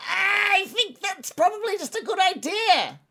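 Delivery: high, slow little goblin voice. Acted. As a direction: squeak it out like a nervous goblin. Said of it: "kind of looks up at you and says".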